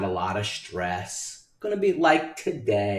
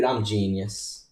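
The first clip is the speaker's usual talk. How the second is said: ordinary talk, nothing marked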